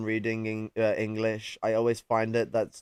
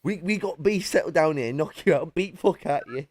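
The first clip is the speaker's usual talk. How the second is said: Geordie; 6 semitones higher than usual. Pretend. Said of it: "vocal pressure necessary for the Geordie".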